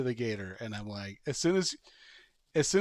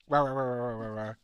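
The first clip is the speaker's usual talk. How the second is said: quick yappy bark sounds, lightly voiced; mock dog barking, rah rah rah; imitated dog barks. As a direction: bark it out like a charging little dog. Dog charging second time.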